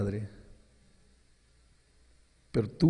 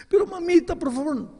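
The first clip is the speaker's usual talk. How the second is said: put-on child's plea; 3 dB louder than usual. Boy pleading with mother.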